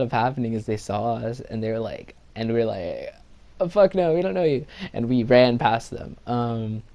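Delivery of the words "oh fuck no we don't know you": higher, louder blurted denial; emphatic, hammed-up disowning; loud mock refusal. Boys passing girls in trouble, loud high denial.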